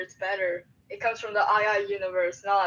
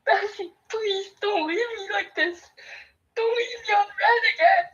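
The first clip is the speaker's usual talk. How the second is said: high, loud, whiny begging; mock pleading cry; 10 semitones higher, 5 dB louder than usual. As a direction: beg dramatically like a jilted friend. Pleading not to be ignored.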